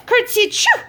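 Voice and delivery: high loud sneeze. A narrator's reading. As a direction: sneeze it out sharply. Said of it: "Peter sneezed".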